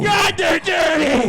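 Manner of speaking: high, slow shouted disgust; mock shout. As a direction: drag it out as a yell.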